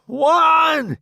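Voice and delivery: high loud yell; shout. Acted. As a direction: bark the count. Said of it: "he shouts".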